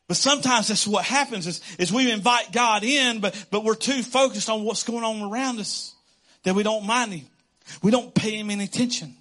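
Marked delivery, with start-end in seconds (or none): none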